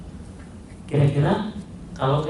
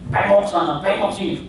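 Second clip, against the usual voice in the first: ordinary delivery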